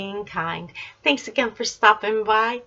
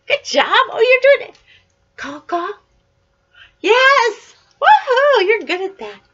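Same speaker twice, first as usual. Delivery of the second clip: excited praise; 8 semitones higher, 6 dB louder than usual. Real, not acted. Praising child's crow sound.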